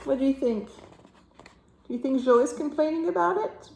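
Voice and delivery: put-on reading to a toddler. Reading picture book to child.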